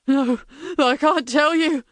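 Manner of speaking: high, frantic begging; desperate pleading. Acted. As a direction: beg for your life. Prisoner refuses.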